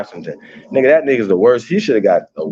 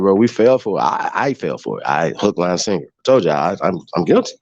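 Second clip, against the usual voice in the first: usual speaking voice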